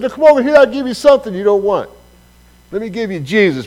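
loud, high call across the street; loud, cheeky call back. Retold or imitated. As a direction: shout the invitation over the road. Calls back to the guys.

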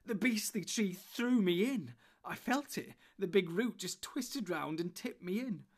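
high, loud, slow and shaken; upset. Acted. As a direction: blurt it out still rattled. Frodo shaken after near drowning.

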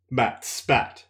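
usual speaking voice

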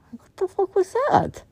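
high, incredulous outburst; outraged disbelief. Acted. Fan reacting in disbelief to the finale. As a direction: squawk in disbelief at the ending.

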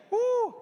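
high shouted woo; loud whoop. Real, not acted. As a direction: let out a joyful whoop. Preacher whoops to congregation.

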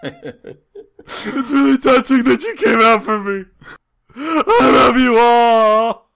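hammed-up weepy thanks, breaking into sobs and a shout; mock tearful birthday speech. Thanking the party guests, mock crying. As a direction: overplay being moved to tears. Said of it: "I'm actually tearing up a little bit".

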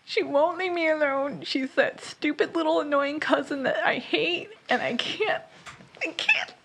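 high, put-on dog voice speaking for Winter; voicing the dog. Owner speaking as her stressed dog, high pretend voice. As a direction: squeak out the dog's complaints in a high pretend voice.

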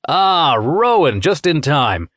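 slow, bright cheerful greeting, sinister undertone; cheerily. Acted. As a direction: welcome him far too pleasantly. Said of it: "he said cheerily".

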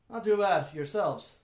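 lightly voiced friendly elf; lifted, loud opening easing off; a hint of a chatty elf; elven stranger. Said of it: "this elven individual".

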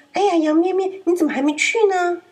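wolf pretending to be in a hurry; hammed-up anxious wolf; feigned urgency. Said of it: "就很着急地说：".

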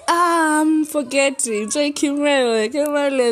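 loud, high burst of remembering; excited recall. Real, not acted. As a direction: blurt the name out as it comes back. Suddenly remembering a friend's name.

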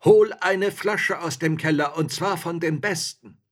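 loud, high command; called order. A narrator's reading. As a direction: robber chief shouting for wine. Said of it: "rief der Alten zu".

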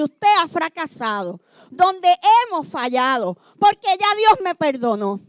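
predicando con fuerza, más alto y fuerte; predicación enérgica. Acted. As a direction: proclamar el perdón de Dios a la congregación. Predicación a toda voz. (pastor a su iglesia, predicación a toda voz)

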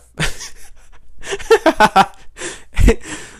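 high burst of real laughter; helpless laugh. Real, not acted. Vlogger botching a hard word, helpless laugh. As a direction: crack up at your own stumble. Cracks up at own mispronunciation.

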